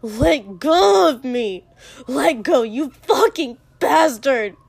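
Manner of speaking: loud, slow, straining yells; furious struggling shout. Acted. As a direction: yell to be released from a grip. Struggling to wrench free.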